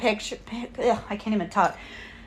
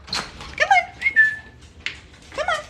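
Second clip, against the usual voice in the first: calling the bird; 3 dB quieter, slower than usual. Real, not acted.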